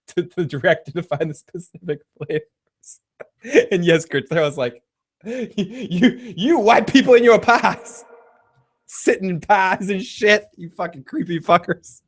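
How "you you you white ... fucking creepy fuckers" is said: laughing mock rant. Mocking the pie people, laughing through a mock rant. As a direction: rant at them while cracking up, pitch jumping high.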